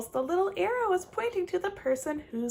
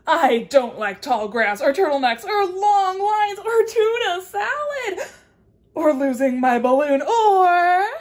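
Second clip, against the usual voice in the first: loud, slowed, whining list; mock whiny complaint; 5 semitones higher, 9 dB louder than usual. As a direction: pretend to be upset. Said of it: "what she doesn't like".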